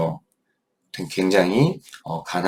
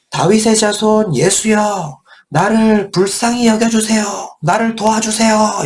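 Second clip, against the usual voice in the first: crying out loud; 11 semitones higher, 3 dB louder than usual. Acted. Blind beggar shouting to Jesus, begging loudly for help. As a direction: cry out for help with everything. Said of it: "이렇게 소리 지른 거예요".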